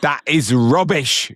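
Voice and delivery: high, slow scornful British voice; British accent. Pretend. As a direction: dismiss it like a snooty Brit. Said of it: "say everything British we can think".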